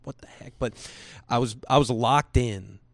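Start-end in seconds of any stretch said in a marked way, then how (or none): 0.0-0.6 whispered, baffled aside; confused whisper. Retold or imitated. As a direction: breathe it out, lost in the text. Retelling own confused reaction.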